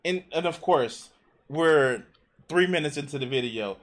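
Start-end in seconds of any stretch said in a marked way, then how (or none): none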